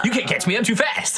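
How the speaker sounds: high sing-song taunt; taunting creature voice. Acted. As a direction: tease them, high and cheeky. Hog taunting player characters.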